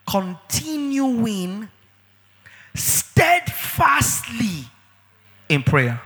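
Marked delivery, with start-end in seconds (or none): 0.1-4.7 continuing steadfastly stretched very slow; slow emphatic preaching; drawn out. Acted. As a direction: stretch out every syllable for emphasis. Preacher drawing out key words.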